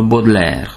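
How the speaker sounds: Baudelaire with au said as a pure O; French Baudelaire, O in the first syllable. Shown as a demonstration. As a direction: say Baudelaire as "bod-lair". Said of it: "O-nak ejtjük".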